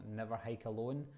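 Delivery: ordinary delivery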